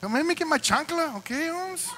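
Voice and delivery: high scolding mom threat; Mexican mom voice. Retold or imitated. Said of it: "my kids are Mexican".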